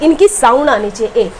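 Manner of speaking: ordinary talk, nothing marked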